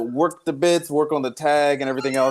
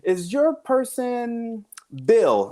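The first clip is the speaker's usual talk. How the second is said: imitating daughter; 9 semitones higher, slower than usual. Daughter voiced in Guess Who.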